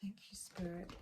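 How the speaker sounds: soft unvoiced whisper. Real, not acted. Reader whispering thanks to spirit. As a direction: breathe a thank you.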